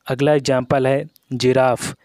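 ordinary talk, nothing marked

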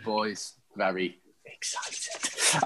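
slow, low, very soft repeat; hushed excitement. Real, not acted. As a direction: drop right down and draw it out softly.

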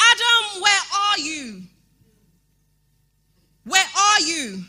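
God calling loud for Adam; a loud, searching cry. Acted. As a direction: call out as if searching a garden. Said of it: "he cried out to say".

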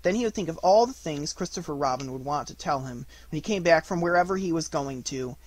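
ordinary delivery, nothing marked

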